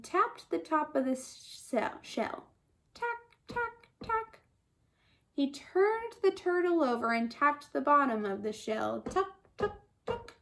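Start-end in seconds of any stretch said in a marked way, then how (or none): 2.9-4.4 high, soft, clicky TACK; tiny light knocks on "Tack, tack, tack"; tapping sound. A narrator's reading. Lion tapping top of shell, high soft taps. 9.0-10.3 soft, short TUCK knocks; hushed little taps on "Tuck, tuck, tuck"; tapping sound. A narrator's reading. Lion tapping bottom of shell, muted taps.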